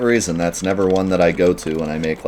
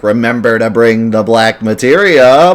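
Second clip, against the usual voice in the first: goofy villain; 3 semitones higher, 7 dB louder than usual. Retold or imitated. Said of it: "just a goofy villain in some movie".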